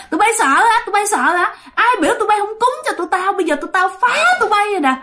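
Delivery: high, fast, piercing taunt; taunting ghost. Retold or imitated. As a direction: jeer at the scared soldiers like a spiteful ghost. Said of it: "mà chát chúa". (giọng ma chế giễu, cao và the thé)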